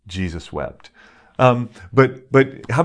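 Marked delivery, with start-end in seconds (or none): 0.1-0.9 Jesus wept, said much softer and lower; hushed repeat. Shown as a demonstration. Preacher closing on the verse for the congregation, hushed and low. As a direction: drop Jesus wept almost to a hush. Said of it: "he weeps for you and I?".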